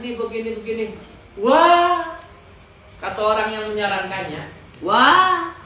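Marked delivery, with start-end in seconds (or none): 1.4-2.2 sharp, loud, high "Wah"; surprised wah. Real, not acted. Teller's sudden exclamation. 4.8-5.5 "Wah" thrown out high and loud; surprised wah. Acted. Adviser exclaims at the story.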